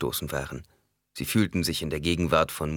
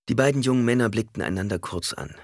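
ordinary delivery, nothing marked

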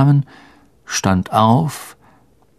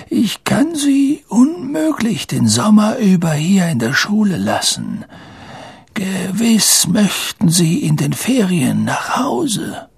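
put-on high, frail old headmaster, friendly; Dippet, kindly; 8 semitones higher than usual. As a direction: feeble old man kindly reasoning with a pupil. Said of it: "sagte Dippet freundlich". (freundliche, hohe Greisenstimme)

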